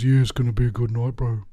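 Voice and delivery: imitating an unenthused South Island guy; mock unenthusiastic. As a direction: say it with no excitement at all, hurried. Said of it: "the lack of enthusiasm is absolutely real".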